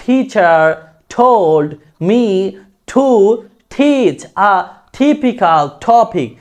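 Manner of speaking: "teacher told" with every T aspirated, first word by word, then as a line. Shown as a demonstration. Teacher drilling the sentence, aspirated T. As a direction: drill "teach a typical topic" with puffed ঠ onsets. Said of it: "ঠর মতো হবে".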